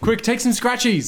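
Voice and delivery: excited robber's call; urgent hustle. Acted. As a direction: urge the gang on in a high-pitched rush. Urging a robbery after knockout.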